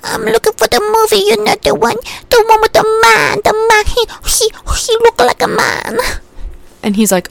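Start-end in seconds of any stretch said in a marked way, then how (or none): 0.0-6.0 very high comic character voice; Bunny Swan. Acted. Clueless customer describing a movie to clerk, high comic voice. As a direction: ramble cluelessly, pitched way up. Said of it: "And she's like".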